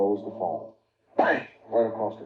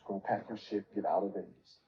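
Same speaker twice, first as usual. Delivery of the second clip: much softer than the storytelling; retold hushed order; 11 dB quieter than usual. Reggie telling him to leave Vegas, retold hushed. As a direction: quote it under your voice.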